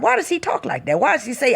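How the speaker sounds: usual speaking voice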